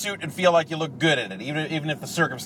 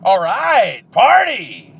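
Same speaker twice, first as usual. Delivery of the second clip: sarcastic cheer; 4 semitones higher, 9 dB louder, much slower than usual. Acted. Ironic cheer at caller's wake news.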